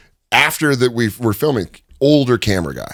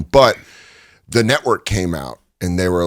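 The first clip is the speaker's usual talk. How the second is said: ordinary delivery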